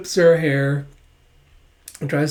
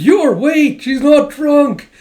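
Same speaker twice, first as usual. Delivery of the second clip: much higher and louder, desperate; calling out; 9 semitones higher, 8 dB louder, slower than usual. Retold or imitated. Lloyd chasing Yor out the door, desperate shout. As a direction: shout after her as she runs.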